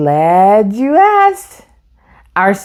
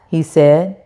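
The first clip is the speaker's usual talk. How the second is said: plain reading voice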